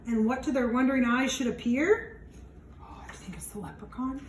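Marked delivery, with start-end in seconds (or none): 2.8-4.2 hushed whisper aside. Real, not acted. Teacher whispering to the class about the leprechaun. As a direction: breathe it as if the leprechaun might hear.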